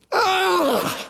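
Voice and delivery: high-pitched groaning cry; mock shriek. Preacher acts out Job's groan at a meal, shrieking. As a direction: cry out in revulsion on a high held note. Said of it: "The Hebrew word means to shriek".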